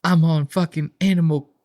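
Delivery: a dad voiced defending his job to his son; mock Joel voice. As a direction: play the dad justifying himself. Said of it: "Joel's like".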